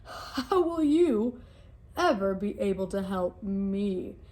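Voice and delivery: booming king of the jungle, amused; mocking lion. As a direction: lord it over the little mouse. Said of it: "Lion roared with laughter".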